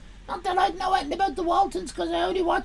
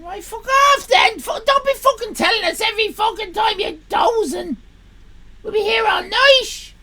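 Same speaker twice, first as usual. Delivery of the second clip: loud, higher exasperated yell; fed-up shout; 4 semitones higher, 7 dB louder than usual. Real, not acted. Streamer yelling at a viewer who keeps announcing bedtime, loud. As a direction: shout him off in mock fury. Angry outburst at a departing viewer.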